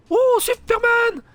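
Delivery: very high, slow drawn-out "Oh, Superman!"; stretched astonished exclamation; amazed cry. Real, not acted. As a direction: marvel at the flying enemy. Enemy leaps through air.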